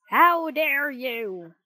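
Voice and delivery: loud, very slow outraged shout; old man furious at being poked; storybook outrage, drawn out; angry shout. A narrator's reading. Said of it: "Geppetto shouted".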